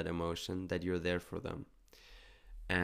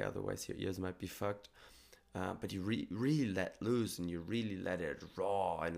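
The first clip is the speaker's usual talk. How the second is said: ordinary delivery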